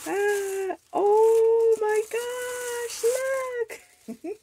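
very high, drawn-out squeal of delight; excited squeal. Real, not acted. Unboxing a friend's gift, a long high thrilled squeal.

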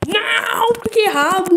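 loud, high howl of dismay; frustrated shout. Real, not acted. Player choosing the wrong option, frustrated yell. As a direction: yell out at your own mistake.

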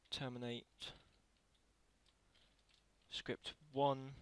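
normal talking voice

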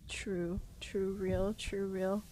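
whispered low chant; whispering. Real, not acted. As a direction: murmur the words under breath.

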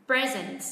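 "present" as PRE-zent, second syllable falling; stress on first syllable. Shown as a demonstration. Teacher models the noun or adjective, first-syllable stress on "present". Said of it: "Chúng ta hạ giọng âm tiết thứ hai".

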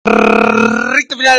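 long, stretched call; drawn-out announcement. Real, not acted. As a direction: stretch the words slowly. Announcing the home straight. (anuncio estirado y lento)

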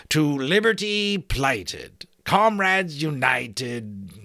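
slow, careful hearty giant voice; giant repeating carefully. A narrator's reading.

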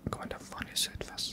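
hushed whisper, keeping silent. Real, not acted. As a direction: whisper every word.

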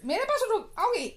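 high, loud coaxing question. Real, not acted. Coaxing toddler to come. (बच्ची को ऊँची आवाज़ में फुसलाते हुए)

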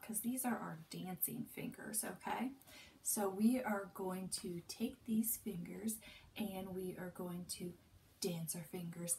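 put-on hushed whisper to children. Shown as a demonstration. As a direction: whisper it softly. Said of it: "whispered to children".

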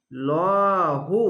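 লহু drawn out very slowly with a full, heavy লাম; heavy lam recitation. Shown as a demonstration. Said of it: "খাড়া যাবার এক আলিফ টেনে পড়বেন". (মোটা করে টেনে লহু)

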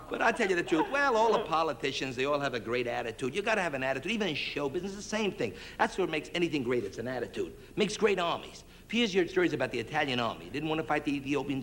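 normal talking voice

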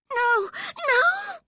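very high, loud shriek; frantic high-pitched scream. A narrator's reading. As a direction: scream out in desperate refusal. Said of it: "she screamed".

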